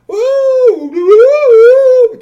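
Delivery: high, loud crew whooping; shouted alien whoops. A narrator's reading. Crew members salute their crown prince, shouted whooping. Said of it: "came up and all shouted".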